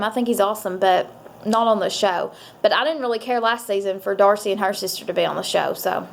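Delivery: ordinary talk, nothing marked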